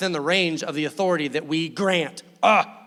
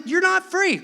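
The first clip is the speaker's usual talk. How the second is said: much higher and louder, a ringing declaration; God's loud verdict; 13 semitones higher, 6 dB louder than usual. Retold or imitated. Preacher voicing God telling people they're not free, loud and high. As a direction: cry it out like a verdict from above.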